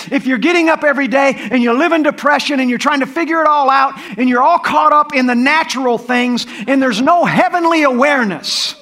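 rising fervent preacher; preaching. Acted. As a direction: build the sermon with passion. Preaching builds to full force.

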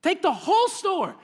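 generous exclamation. Retold or imitated. God giving the whole toy store, high and delighted. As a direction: hand over everything with delight. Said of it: "he just said".

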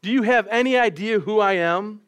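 mock loud, arrogant demand; a pompous passenger loudly demanding who he is. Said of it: "he asked loudly".

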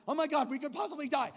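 high, loud dawning panic; sudden fear. Retold or imitated. Realizing the danger in the tunnel, panicked. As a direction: act out catching the kid's fear. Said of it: "a spirit of fear".

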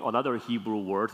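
ordinary talk

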